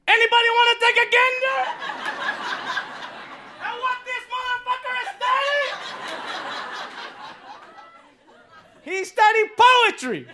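fast, high exasperated father yelling; outraged immigrant dad. Retold or imitated. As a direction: play the furious father who crossed the sea for this. Dad ranting about son's poetry.

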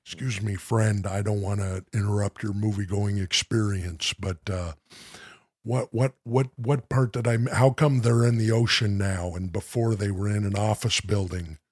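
low, soft, polite Ventura; hushed Ventura whisper in a cinema; Jesse Ventura. Acted. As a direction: quietly ask like Jesse Ventura. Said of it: "say something like".

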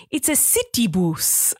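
imitating a child's put-on British accent; city bus drawn out in a mimicked accent; copied video accent. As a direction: say it in a toddler's borrowed accent. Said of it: "the man has an accent".

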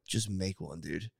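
low calm insistence. Retold or imitated. To the ice cream worker, low coaxing insistence. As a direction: press him gently but firmly.

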